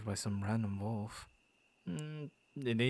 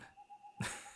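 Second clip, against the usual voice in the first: sinister chuckle; 11 dB quieter than usual. Acted. After asking what he does.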